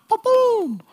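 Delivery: high-pitched "ba-boom" sound effect; imitated boom. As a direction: pop out a high, sudden ba-boom. Imitates his sudden moment of realization.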